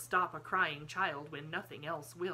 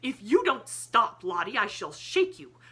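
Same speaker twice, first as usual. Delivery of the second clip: sudden angry threat; 5 semitones higher, 12 dB louder than usual. A narrator's reading. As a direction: snap from cooing to threatening. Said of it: "in quite another tone".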